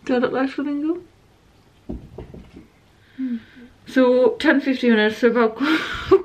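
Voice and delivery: talking through a face mask; wearing a sheet face mask. Real, not acted. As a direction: lips stiff under mask. Seen 2: Pink sheet mask covers face; mouth hole open; off by 20.7.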